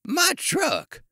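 put-on high, loud outraged cry from an old ice cream man; yelled. A narrator's reading. Old ice cream man sees his wrecked truck, yelling. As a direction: yell it out, pitch shooting right up. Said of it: "he yelled".

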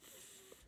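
th as a soft breathy hiss with the tongue between the teeth; teacher sounding out th. Shown as a demonstration. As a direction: blow out a quiet unvoiced th. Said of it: "T H, remember, says".

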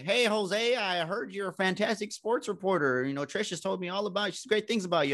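high, hammed-up country accent. Director greeting Jose about sports reporting, high mock country accent. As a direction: imitate him in a big country accent. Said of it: "he had this country accent".

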